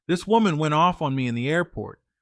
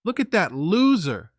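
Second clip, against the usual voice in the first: higher, louder taunting jeer, leaning on loser; mocking jeer; 6 semitones higher, 4 dB louder than usual. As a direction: jeer at him in public. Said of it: "She's just laughing at me".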